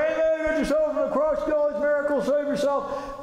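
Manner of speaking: high-pitched heckling, imitated; mocking jeer. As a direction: call it out like a taunting crowd. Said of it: "all these people are making fun".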